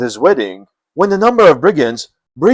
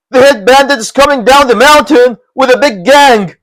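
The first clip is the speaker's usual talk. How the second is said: higher, urgent announcement; alarmed vassal; 9 semitones higher, 4 dB louder than usual. Acted. Vassal announcing the bandits to Lu, alarmed. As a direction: warn the master.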